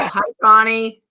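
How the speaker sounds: squeaky hoarse voice from the flu; squeaky sick voice. Real, not acted. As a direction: talk through a squeaky sore throat. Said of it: "My voice is still on strike".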